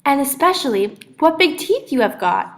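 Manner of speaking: put-on high, loud young girl voice; a bright little girl questioning her grandma; little girl Cindy, puzzled. A narrator's reading. Cindy telling the wolf-grandma she looks different, high and loud.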